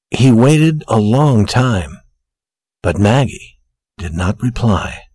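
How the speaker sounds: plain reading voice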